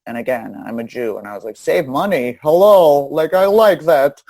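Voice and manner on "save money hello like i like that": higher, louder, slowed-down gleeful take on it; mock delight. To the dealer pitching savings, a hammed-up thrilled cheer. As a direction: ham up a thrilled little cheer.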